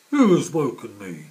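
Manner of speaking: loud grumpy roar; grumpy shout. Acted. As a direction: bellow at being woken. Woken badger.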